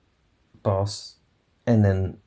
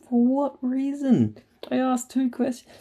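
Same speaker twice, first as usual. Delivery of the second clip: incredulous outrage. Retold or imitated. Telling the boss off over the complaint, high incredulous. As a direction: pitch it way up in disbelief. Retelling own outraged reply.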